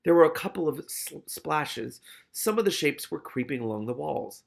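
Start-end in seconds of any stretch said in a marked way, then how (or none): none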